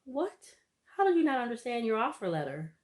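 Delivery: how do you not get this; incredulous. Real, not acted. Recapping a reality show, baffled at him not understanding his letter. Disbelief at husband's offer letter.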